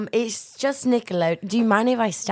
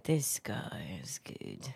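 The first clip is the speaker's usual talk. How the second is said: whispered thought; 13 dB quieter, slower than usual. Retold or imitated. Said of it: "inner thought quoted".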